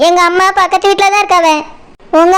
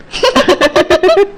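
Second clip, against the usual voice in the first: light teased giggle; bride's bashful little laugh; 3 semitones lower than usual. Acted. Bride teased about daydreaming of groom.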